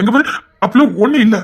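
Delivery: ordinary talk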